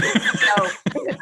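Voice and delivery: loud open laugh; real laugh burst. Real, not acted. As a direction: laugh out at the memory. Recalling the spider scare.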